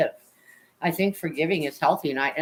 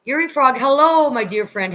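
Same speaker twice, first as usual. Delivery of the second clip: excited greeting; 7 semitones higher, 7 dB louder than usual. Real, not acted. Greeting a chat viewer warmly.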